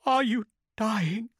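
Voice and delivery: high, slow, tearful woman; through tears. A narrator's reading. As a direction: ask it haltingly, high, near crying. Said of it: "she asked through the tears".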